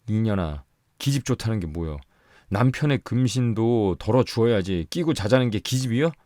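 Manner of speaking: scolding, building to a loud, high rant; furious. A narrator's reading. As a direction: tear into her. Husband berates his wife.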